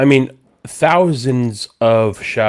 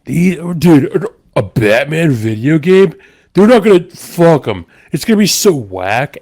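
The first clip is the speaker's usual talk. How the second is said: incredulous, louder, higher mock rant; scoffing past self; 6 semitones higher, 5 dB louder than usual. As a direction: sneer at the idea like a snobby fan. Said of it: "I was like".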